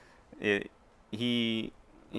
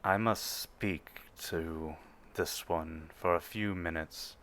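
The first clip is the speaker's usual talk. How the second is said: imposing Imperial officer; 2 semitones lower, 8 dB quieter than usual. Acted. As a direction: speak down from seven feet tall, unhurried. Officer asks privacy.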